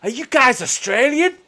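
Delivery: high, loud, drawn-out question; excited American tourist. Retold or imitated. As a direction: mimic an amazed American tourist. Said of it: "all the Americans around us".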